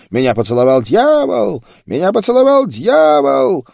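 high loud wail, climbing on the repeat; old man's frenzied yell. A narrator's reading. Bitten old man screaming to everyone about the devil, frenzied high yell. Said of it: "заорал старик, выпучив глаза".

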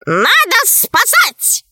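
very high, drawn-out bird voice; urgent talking bird. A narrator's reading. Right head urges a rescue, high slow bird voice. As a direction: sound the alarm as a high bird. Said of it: "сказала правая голова".

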